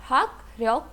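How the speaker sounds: "हाक ryok" with K closing हाक and R opening ryok; pronounced as spelt. Shown as a demonstration. Teacher quizzes learners, "हाक ryok" with written K and R. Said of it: "क्या pronounce होगा?".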